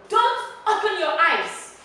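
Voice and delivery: loud, high order with a falling end; forceful teacher's demo command; firm command. Shown as a demonstration. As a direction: order it like you must be obeyed. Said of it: "I command you".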